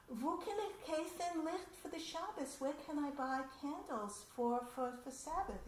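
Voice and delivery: a light Yiddish-flavoured plea for directions; Yiddish-speaking woman. Retold or imitated. Immigrant woman asks passers-by for Sabbath candles, lightly voiced Yiddish plea. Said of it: "the woman was speaking in Yiddish".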